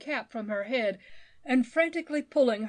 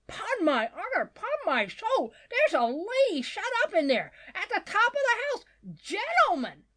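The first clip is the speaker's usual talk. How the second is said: high, urgent plea to a crowd; frantic screamed appeal; 11 semitones higher, 4 dB louder than usual. A narrator's reading. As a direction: insist at a high pitch. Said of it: "screamed out Mister Badger".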